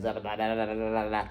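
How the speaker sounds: low monotone run of "da da da"; rapid keyboard-tapping imitation. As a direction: mimic furious typing with a string of syllables. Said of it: "tapping the keyboard".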